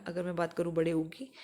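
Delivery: usual speaking voice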